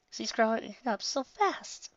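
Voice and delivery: slow, high, a touch tearful; holding back tears. Acted. Proud mother talking to herself, a touch tearful. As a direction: fight the tears as you say it. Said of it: "as she fought tears back".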